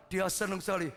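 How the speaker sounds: usual speaking voice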